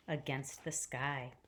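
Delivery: ordinary delivery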